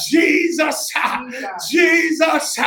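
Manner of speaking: fervent drawn-out shouts with space between; screaming his name. Real, not acted. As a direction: cry the name out to the neighbors. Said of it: "I'm gonna scream his name".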